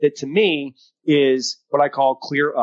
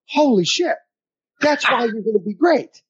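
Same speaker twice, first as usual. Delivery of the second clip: higher, amazed "holy shit"; excited exclamation; 8 semitones higher than usual. Retold or imitated. Coach praising the athlete's checklist, amazed and excited. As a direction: react with real amazement to good work. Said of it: "And I went".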